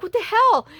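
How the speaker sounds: high-pitched, imitated startled reaction; retold startled yelp of a question; startled outburst. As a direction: blurt it high, acting out disbelief. Acted startled son on phone.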